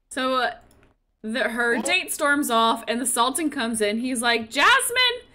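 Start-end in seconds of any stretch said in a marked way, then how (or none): 4.5-5.2 high-pitched retold call to a daughter. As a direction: sing out the name, pitched well up. Sultan calls out to daughter.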